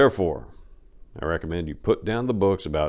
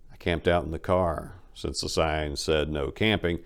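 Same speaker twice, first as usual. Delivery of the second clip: ordinary delivery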